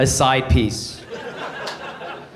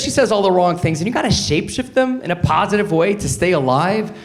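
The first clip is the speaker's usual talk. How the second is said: normal talking voice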